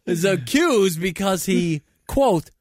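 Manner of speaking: high, punchy comedy newscast; mock news read. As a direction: deliver it like a satirical anchor. Reads mock news items.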